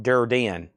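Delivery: "Durden" stretched to dur-dee-en; "Durden" with an extra ee syllable; wrong name, dur-DEE-en. Shown as a demonstration. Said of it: "Uh, not Durden".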